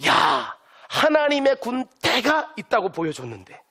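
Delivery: usual speaking voice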